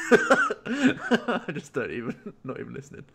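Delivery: high, loud burst of laughter through the words; laughing. Real, not acted. Friend laughing that Joe isn't even listening, high and loud. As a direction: laugh it out, giving up.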